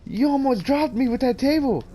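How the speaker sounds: high-pitched mock complaint; confronting. Friend confronts him after running, high-pitched complaint. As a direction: imitate an aggrieved friend, pitched well up. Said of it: "he confronted me".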